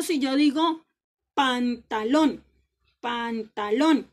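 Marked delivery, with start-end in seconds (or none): 1.3-2.4 pantalón slow and loud, with LÓN hit hardest; stress on LÓN. Shown as a demonstration. Teacher quizzing pupils on the tonic syllable, pantalón stressed on LÓN. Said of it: "cuál es la sílaba tónica". 3.0-4.1 pantalón slowly by syllables, loud on LÓN; stress on LÓN. Shown as a demonstration. Teacher repeating the quiz word, pantalón stressed on LÓN. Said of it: "cuál es la sílaba tónica".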